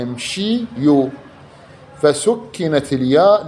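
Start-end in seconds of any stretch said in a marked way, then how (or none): 0.0-1.1 slow امشيو ending in a long "yuu"; damma on ya. Shown as a demonstration. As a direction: draw out امشيو, separating "shii" from "yuu". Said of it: "وضم الياء".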